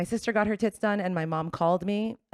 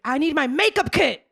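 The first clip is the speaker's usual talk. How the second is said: higher, louder urgent demand; shrill demand from the hospital bed; demanding sister; 8 semitones higher, 6 dB louder than usual. Retold or imitated. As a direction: bark it out like a frantic new mom. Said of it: "She's like".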